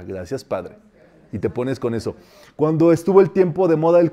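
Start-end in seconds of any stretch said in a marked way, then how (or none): 0.0-0.8 low, soft, falling; quiet closing thanks. Shown as a demonstration. Teacher closing the decree demonstration, low soft thanks. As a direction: let it drop into a private amen.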